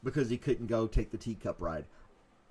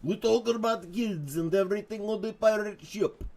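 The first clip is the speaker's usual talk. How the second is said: Russian pirate; 7 semitones higher than usual. Acted. Host demonstrating Russian pirate, hammed-up accent. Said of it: "How would a Russian pirate sound?".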